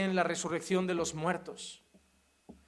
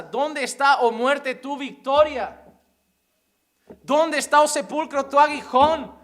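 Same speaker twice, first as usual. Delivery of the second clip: loud, high proclamation of the verse; preaching at full force; 6 semitones higher, 10 dB louder than usual. Acted. Preacher to his brothers, proclaiming the verse loud. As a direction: cry it out to the congregation.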